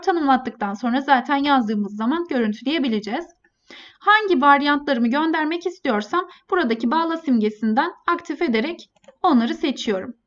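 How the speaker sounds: ordinary talk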